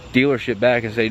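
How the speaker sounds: normal talking voice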